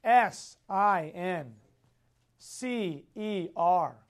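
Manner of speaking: letters spelled out very slowly; slow spelling. Shown as a demonstration. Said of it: "this is how you spell it".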